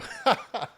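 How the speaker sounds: sudden high-pitched burst of laughter. Real, not acted. Host laughs at his refusal.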